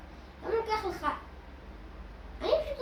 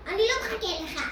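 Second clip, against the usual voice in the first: ordinary talk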